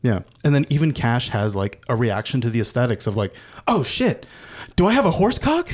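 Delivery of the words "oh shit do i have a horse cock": high-pitched, startled disbelief; high, comic panicked question; mock alarm. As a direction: voice a character's horrified discovery. Voices character's shocked reaction.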